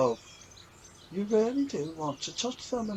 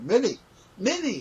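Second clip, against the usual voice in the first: Derek's call, corrected; 9 semitones higher, 5 dB louder than usual. Retold or imitated. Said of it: "he didn't say Minnie, he said".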